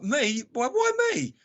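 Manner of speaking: high-pitched mock whining; petulant, squeaky protest; petulant whine. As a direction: whine it like a child being picked on. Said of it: "I'm a petulant bloody child".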